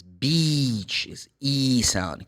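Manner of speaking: slow, drawn-out ee in "beach"; long E sound. Shown as a demonstration. Teacher explains the vowel to learners, long E in beach. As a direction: stretch "beach" into a long, held ee. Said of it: "make the sound longer".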